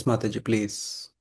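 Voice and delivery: ordinary talk, nothing marked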